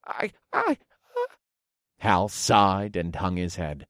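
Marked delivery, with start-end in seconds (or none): 0.1-1.3 very high stammering thief; frightened thief. Acted. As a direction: stammer in panic.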